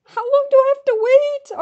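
high, loud impatient question; agitated impatience. Real, not acted. Fan stuck in ticket queue, agitated impatience. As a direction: blurt it out high and frantic.

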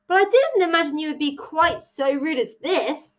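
understated indignant scolding; snapping. A narrator's reading. Tilly scolds the Hare, mildly snappy. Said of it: "Tilly snapped".

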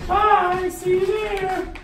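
high, loud, drawn-out farewell; put-on Mandy voice. Friend says goodbye as Mandy, high put-on voice. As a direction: sing out the goodbye in a squeaky voice. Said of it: "I do Mandy's voice".

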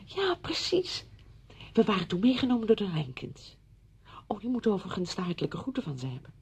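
usual speaking voice

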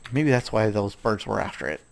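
ordinary talk, nothing marked

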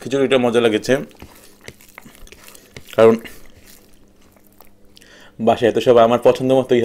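talking with food in mouth; words muffled by chewing; chewing while talking. Real, not acted. Seen 2: eats khichuri by hand before; lips closed, cheeks working early on.

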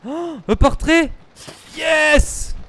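high thrilled cry; excited. Real, not acted. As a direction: cheer at the find. Finds collectible.

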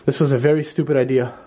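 ordinary talk, nothing marked

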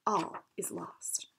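hushed whisper, slow and breathless; whispered despair. A narrator's reading. Clockmaker to Madison, whispering that all is lost.

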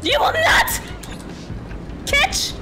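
high, punched cries in bursts; high-pitched, defiant panic; frantic refusal. Real, not acted. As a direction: yelp each word while fleeing. Frantic refusals while chased.

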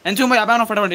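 calling out. Real, not acted. Calling teammate for help mid-fight.

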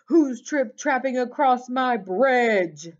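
booming, indignant troll challenge; troll's roar. A narrator's reading. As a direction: roar up at a trespasser. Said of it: "terrible roar rose up from below".

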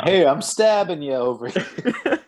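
slow, high New York tough guy; a touch of New York brash; New York accent. Acted. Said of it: "in a New York accent".

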